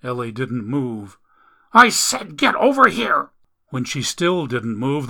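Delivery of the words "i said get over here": loud, high-pitched furious mother; angry order. A narrator's reading. Mother orders unmoving Ellie over, loud angry shrieked order. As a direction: yell the command at your disobedient child.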